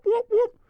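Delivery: high, loud goofy whoops; silly whoop noise. Shown as a demonstration. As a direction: whoop like a cartoon siren, nose pinched. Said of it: "make a silly noise like whoop".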